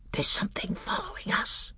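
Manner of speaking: urgent hushed whisper; low whisper. Acted. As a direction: whisper it so the thing won't hear. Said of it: "he said in a low voice".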